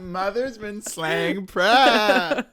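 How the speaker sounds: very high, loud and drawn-out; hammed-up diva proclamation. Friends joking about mother slaying prep, hammed-up diva crow. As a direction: crow it slowly like a mock diva. Climax of the slay bit.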